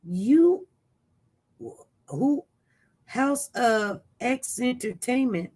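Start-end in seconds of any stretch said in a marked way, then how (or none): none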